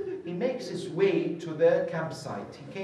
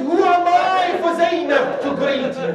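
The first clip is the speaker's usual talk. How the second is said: high, loud, crying out in grief; loud, high lament from the pulpit; anguished cry; 12 semitones higher, 13 dB louder than usual. Acted. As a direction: wail it as if unworthy of the greeting.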